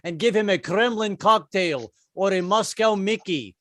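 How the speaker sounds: hammed-up mock Russian accent; slowing, shakily held Russian accent. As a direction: do a comic Putin in a rough Russian accent. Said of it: "a Russian accent".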